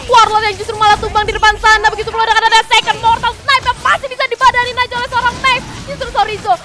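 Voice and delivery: excited play-by-play. Acted. Teamfight call.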